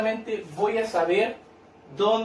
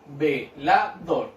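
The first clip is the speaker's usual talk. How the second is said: "velador" very slowly, as ve, la, dor; split into syllables; 5 semitones lower, much slower than usual. Shown as a demonstration. Teacher separates the word for children, syllable by syllable. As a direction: break "velador" into three spaced beats. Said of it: "Separo en sílaba".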